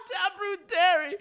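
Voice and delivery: a high laugh running on into the name; high laughing squeal. Real, not acted. Streamer reading another mob nickname, squealing with laughter.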